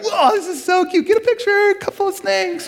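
high, gushing coo over a cute thing; mock cuteness gush. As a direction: squeal it like it's adorable, hammed up. Mock delight over snakes.

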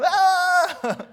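loud, high scream ending in a laugh; overexcited yell. Acted. Overexcited game player who forgot a name, hammed-up scream. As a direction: burst out in a hammed-up holler.

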